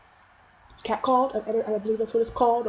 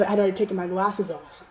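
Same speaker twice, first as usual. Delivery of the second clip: normal talking voice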